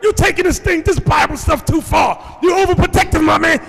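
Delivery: imitating an outraged father-in-law; screaming complaint. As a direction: rant at the top of the voice. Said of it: "He starts screaming".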